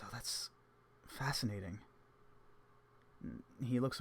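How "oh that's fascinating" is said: breathy, awed whisper; a whispered marvel at the gem; hushed awe. Acted. Evans marvels at the stone.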